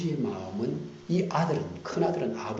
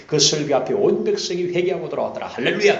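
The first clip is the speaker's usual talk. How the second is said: excited; 6 semitones higher, 8 dB louder than usual. Acted. Joyful happy ending.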